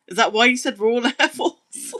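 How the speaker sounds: breaking into a real laugh; amused realisation. Real, not acted. Streamer catching on to a viewer's odd guess, laughing.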